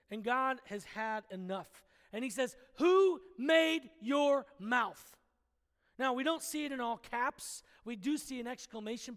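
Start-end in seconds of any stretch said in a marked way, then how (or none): 2.8-5.2 slow, loud and stern, every word weighed; a fed-up God demanding an answer; stern booming God. Acted. God, fed up with Moses's excuses, slow stern booming demand. God has had enough.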